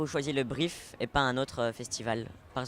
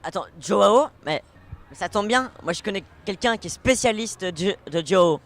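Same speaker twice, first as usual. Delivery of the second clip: excited; 6 semitones higher, 12 dB louder than usual. Acted. Excited interruption, loud.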